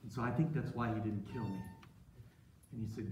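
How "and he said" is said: ordinary delivery, nothing marked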